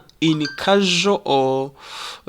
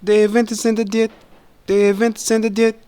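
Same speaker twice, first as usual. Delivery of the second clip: fast, higher run-together words; said quickly; 6 semitones higher, 4 dB louder, much faster than usual. Shown as a demonstration. Teacher modelling connected speech for learners, quick and linked. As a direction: run "They haven't descended yet" together quickly. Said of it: "is said quickly".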